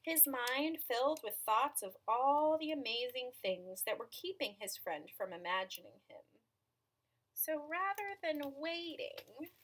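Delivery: measured storytelling with stretched key words; picture-book narration. A narrator's reading. Reader telling children about an imaginary friend, storytelling voice. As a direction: read it to small children, pausing between phrases.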